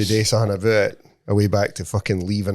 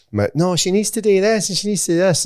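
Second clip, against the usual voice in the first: mock bossy pal; 8 semitones higher, 4 dB louder than usual. Imitating bossy pal at club door.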